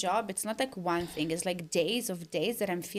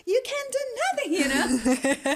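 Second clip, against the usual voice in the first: high, loud, fast wail; exaggerated self-pitying sob-voice; mock crying wail; 10 semitones higher, 6 dB louder than usual. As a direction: wail helplessly, hammed-up. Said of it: "I'm crying, like".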